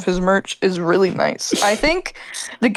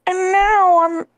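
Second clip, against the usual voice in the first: YouTube poop quote; 10 semitones higher than usual. Retold or imitated. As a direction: stretch it out like a warped clip. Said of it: "What does he say?".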